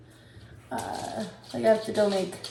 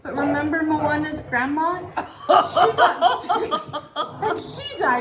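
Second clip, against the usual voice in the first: put-on higher, very slow little-girl voice; daughter's fearful question, slow and high; worried little girl; 6 semitones higher, slower than usual. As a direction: lightly mimic a small child's earnest worry. Mother voices sick daughter.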